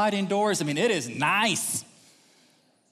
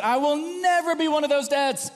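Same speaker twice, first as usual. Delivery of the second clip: much higher, stretched-out declaration; indignant vow; 11 semitones higher, 4 dB louder than usual. Retold or imitated. Expectant father denying he'll be overprotective, indignant. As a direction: protest it emphatically, pitched high. Acting out his past vow.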